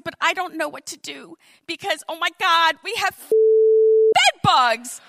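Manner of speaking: freaking out. Retold or imitated. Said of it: "I was freaking out".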